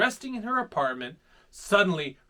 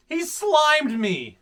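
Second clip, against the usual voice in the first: character voice; 9 semitones higher, 5 dB louder, much slower than usual. Acted. Pete voiced in story.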